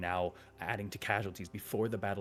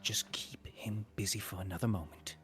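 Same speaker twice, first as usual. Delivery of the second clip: urgent whispered aside. Acted. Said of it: "pokes out and just says".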